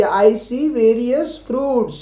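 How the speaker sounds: very slow, each word laid out; slow classroom dictation; slow model sentence. Shown as a demonstration. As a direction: read it slowly so children can repeat. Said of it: "लेकिन मैंने आपके लिए लिखा है, देखिए".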